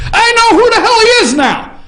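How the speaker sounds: high, loud laughing exclamation; laughing shout. Real, not acted. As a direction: crack up as you shout the reveal. Host's punchline realization.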